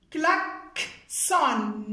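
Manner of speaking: KLAXON slowly broken as klak, pause, son; split in syllables. Shown as a demonstration. Teacher sounding out a new word for pupils, split in syllables. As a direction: say klaxon in two separated beats.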